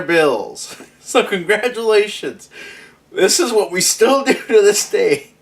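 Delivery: can't keep from laughing at the joke; laughing through it. Real, not acted. Laughing at his own joke.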